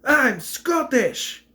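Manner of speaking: high, drawn-out hammed-up Scottish cry; mock Scottish. Scottish character answering what he does, high exaggerated Scottish accent. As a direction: stretch out a comically proud Scottish accent. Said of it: "who's just randomly Scottish".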